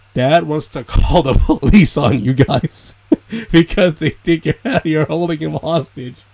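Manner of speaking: reading the family's message aloud, cracking up with laughter; words broken by real laughter; laughing through it. Real, not acted. Telling viewers the police threat, laughing.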